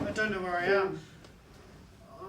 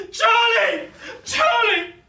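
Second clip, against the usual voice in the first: loud, high, screaming a name; anguished scream; 12 dB louder, much slower than usual. Acted. Mother screaming for Charlie at the wreck, loud and high. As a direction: scream for her lost daughter.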